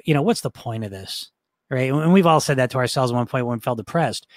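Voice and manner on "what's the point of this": low, soft, gloomy mumble; Eeyore voice. Shown as a demonstration. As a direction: sag into a hopeless Eeyore sigh. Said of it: "The Eeyore voice can say".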